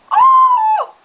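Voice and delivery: very high loud gasp; shocked squeal. Acted. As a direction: squeal in outrage. Shocked gasp in cartoon fight.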